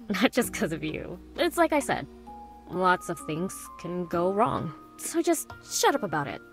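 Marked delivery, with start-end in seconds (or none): 5.0-6.4 mildly snappy. Acted. Brushing off the other's thanks.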